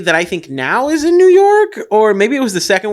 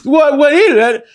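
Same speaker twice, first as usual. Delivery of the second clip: higher, louder, slow taunt; mock tough-guy challenge; 6 semitones higher, 5 dB louder than usual. Wahlberg confronting the driver over foamy beer, higher and louder. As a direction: dare him with a puffed-up bark. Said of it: "And he goes".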